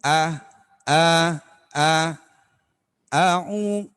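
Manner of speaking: loud open a sounds from the chest; open vowel warm-up. Shown as a demonstration. Teacher shows the real voice with open a sounds. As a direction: sing out a round open ah. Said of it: "Karena suara asli".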